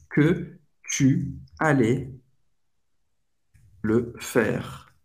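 slow, separated words with long pauses; slow teaching pace. Shown as a demonstration. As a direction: break the French sentence down piece by piece. Teacher breaks the example down slowly. (bem devagar, palavra por palavra)

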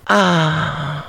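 sudden high shriek of fright; startled scream. Acted. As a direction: yelp as if caught off guard. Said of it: "schrie die Kreatur vor Schreck". (erschrockener Schrei)

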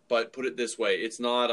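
ordinary talk, nothing marked

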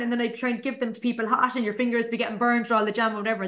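ordinary talk

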